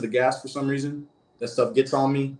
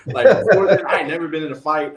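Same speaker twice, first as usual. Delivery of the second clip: laughing it out, a little higher and louder; sheepish laugh through the words; 5 semitones higher, 8 dB louder than usual. Real, not acted. Admitting to host he grew up sheltered, laughing.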